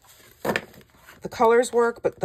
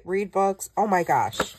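ordinary talk

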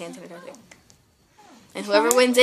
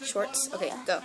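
soft whisper; 13 dB quieter than usual. Shown as a demonstration. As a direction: keep it hushed. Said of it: "the backwards whisper".

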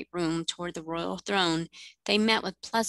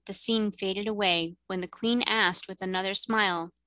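ordinary delivery, nothing marked

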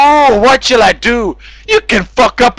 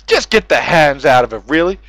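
normal talking voice

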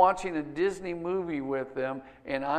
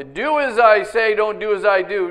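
loud, higher-pitched imitation of a preachy dad; mock dad lecture; 4 semitones higher, 9 dB louder than usual. Dad laying down rules for kids, mock lecture. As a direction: lay down the rule like a dad, mockingly. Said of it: "You ever heard a dad say that?".

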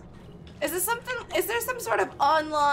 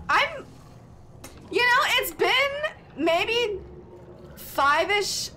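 mock exasperation; 8 semitones higher, slower than usual. Real, not acted. Exaggerated complaint time stuck.

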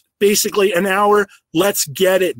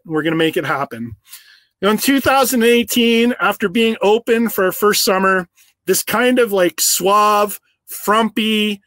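ordinary talk

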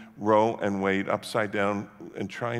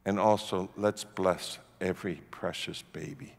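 soft, low, measured blessing; hushed, lowered voice, letting it settle; gentle blessing; 6 semitones lower, 5 dB quieter than usual. Retold or imitated. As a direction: bless them quietly, with long pauses. Gentle blessing to his accusers.

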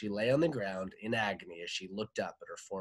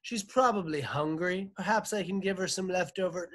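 high-pitched butler voice; butler's lifted voice, hurrying toward the end; 9 semitones higher, 4 dB louder than usual. A narrator's reading. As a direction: suggest it helpfully in a high servant's voice. Butler suggests feeding the visitor.

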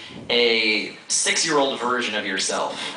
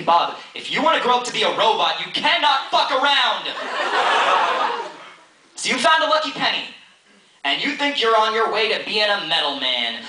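slam poetry; 6 semitones higher than usual. Acted. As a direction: deliver it like a slam poet. Performs a slam poem.

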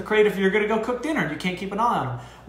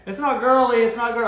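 higher, louder imitation of an indignant client; mock offended protest; 6 semitones higher, 3 dB louder than usual. Female clients objecting to the handshake joke, mock indignant. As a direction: play the insulted client, hammed up. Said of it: "my female clients are gonna yell at me".

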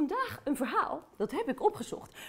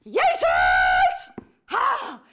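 different voice shout; 7 dB louder, much slower than usual. Acted. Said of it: "Allemaal andere stemmen".

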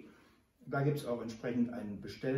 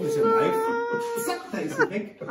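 excited; 10 dB louder than usual. Real, not acted. Child runs off, laughing.

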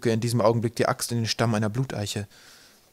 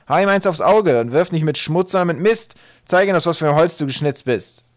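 loud, high shouted fatherly commands; Tuntum; 7 semitones higher, 9 dB louder than usual. A narrator's reading. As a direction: bellow orders at the son, pitched up. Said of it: "erteilte Tuntum ihm Ratschläge und Befehle". (laut gebrüllte Befehle des Vaters)

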